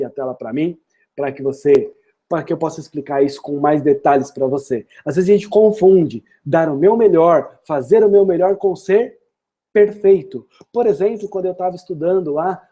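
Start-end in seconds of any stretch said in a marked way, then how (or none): none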